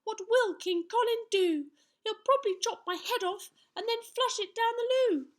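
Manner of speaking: put-on high, loud boyish wail; cried out in dismay. A narrator's reading. Young knight fails to find the underpants, crying out. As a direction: exclaim it high, aghast. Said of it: "cried Sir Scallywag".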